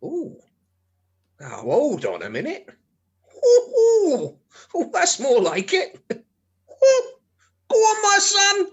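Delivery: high, loud delighted squeals; excited squeals. Acted. Husband peeping through the telescope, high excited squeals. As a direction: squeal with glee.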